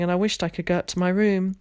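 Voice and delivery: ordinary delivery, nothing marked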